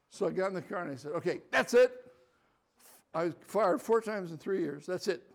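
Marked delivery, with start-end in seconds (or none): 1.1-1.9 loud, high exasperated outburst; fed up. Retold or imitated. Alone in the car telling the Lord, loud fed-up outburst. 4.9-5.2 louder exasperated that's it; fed up. Retold or imitated. Telling the Lord he quits, exasperated. Repeats quitting.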